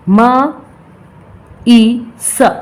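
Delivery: miss split into मा, ई, स with long gaps; sounded out. Shown as a demonstration. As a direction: sound out मा ... ई ... स one by one. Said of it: "Another example is miss". (अलग-अलग ध्वनियों में उच्चारण)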